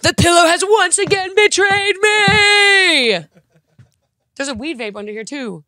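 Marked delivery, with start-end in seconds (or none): 0.0-3.3 high, loud, melodramatic shriek of betrayal; mock outrage. Accusing the pillow of hiding her vape, mock outrage. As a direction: wail it like a wronged soap heroine.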